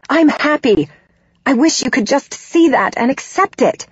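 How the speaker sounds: heated but wounded; upset, pleading protest. A narrator's reading. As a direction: plead to be accepted, voice lifting. Daughter argues back at judgmental mother.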